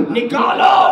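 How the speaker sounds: high loud command; shouted order. Acted. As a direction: bark the last order.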